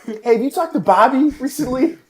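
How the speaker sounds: high-pitched mock Boston accent. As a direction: ask after a buddy in a Boston accent. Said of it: "How about Boston?".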